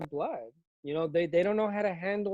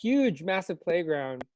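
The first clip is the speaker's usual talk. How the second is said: ordinary talk, nothing marked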